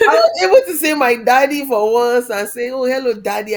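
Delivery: fighting back tears as the joy spills over; emotional. Real, not acted. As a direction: voice lifts high and loud with feeling. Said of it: "don't make me emotional here".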